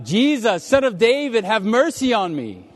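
loud, high-pitched cry for mercy; yelling out. Acted. Blind man calling to Jesus from the roadside, yelling out. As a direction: call out desperately over a crowd. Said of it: "he starts yelling out".

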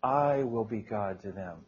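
"I" held long and stressed, the rest falling away softly. Shown as a demonstration. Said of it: "Notice how you emphasize "I"?".

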